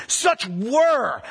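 "were" pressed and falling; stress on were. Shown as a demonstration. Preacher marking the key word for the congregation, stressing "were". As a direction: underline "were" with the voice. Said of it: "Underline were".

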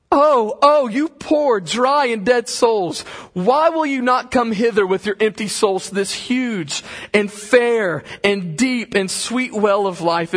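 high, loud pleading sermon voice, building to a cry; impassioned preaching. Acted. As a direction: plead with the crowd at full force. Reads Rutherford's plea preaching.

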